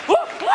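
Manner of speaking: high mock yelps; bitchy noises. Comic admitting his own fear, high mock whooping. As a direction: imitate short scared whoops. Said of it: "these bitchy noises".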